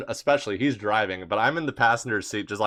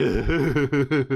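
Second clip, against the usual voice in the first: giggling; 2 semitones higher than usual. Retold or imitated. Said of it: "he's back there giggling to himself".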